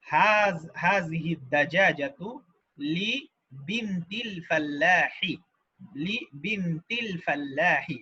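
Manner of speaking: slow, syllable by syllable; slow dictation. Shown as a demonstration. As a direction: spell it out slowly for students. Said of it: "slow dictation of phrase".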